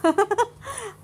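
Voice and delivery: a short high laugh that falls away; amused laugh. A narrator's reading. Abhiram laughing at Ananya's question, amused chuckle. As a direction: laugh at her naive question. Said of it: "Abhiram నవ్వుతూ".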